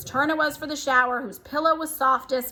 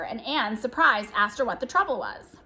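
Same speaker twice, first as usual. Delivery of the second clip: ordinary delivery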